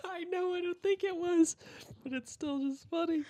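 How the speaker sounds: high voice through laughter; laughing. Real, not acted. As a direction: talk while giggling. Laughing while talking.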